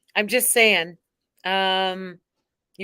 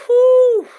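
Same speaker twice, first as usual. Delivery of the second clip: loud whew; 13 semitones higher, 12 dB louder than usual. Real, not acted. Exhale after clapping.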